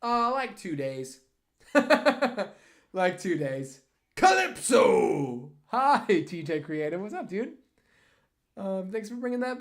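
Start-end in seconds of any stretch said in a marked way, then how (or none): 1.7-2.5 loud laugh. Real, not acted. Laughs after answer. 4.2-6.2 drawn-out loud call. Real, not acted. Greeting a viewer.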